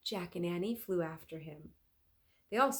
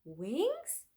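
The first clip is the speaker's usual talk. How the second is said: stunned; 5 semitones higher than usual. Acted. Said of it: "looking stunned".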